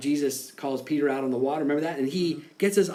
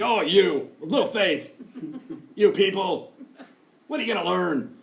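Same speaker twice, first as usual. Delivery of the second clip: mock harsh rebuke. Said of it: "I don't think this was like a harsh rebuke".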